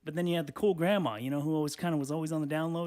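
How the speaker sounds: normal talking voice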